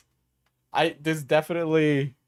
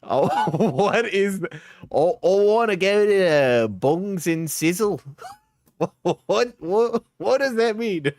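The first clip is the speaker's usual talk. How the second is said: put-on higher, incredulous Queensland accent; 5 semitones higher than usual. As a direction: put on a baffled Queensland Aussie. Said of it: "it's more of a Queensland accent".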